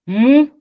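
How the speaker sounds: Mou said high and loud as a tone model; tone demo. Shown as a demonstration. Teacher models Mou's tone for the class. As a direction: give Mou a sharp high tone. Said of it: "c'est l'enfant".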